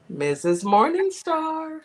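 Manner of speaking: drawn-out, high rising announcer's call; announcer introduction. Real, not acted. Host brings on the affirmation queen, announcer introduction. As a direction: stretch the name upward like a big stage introduction.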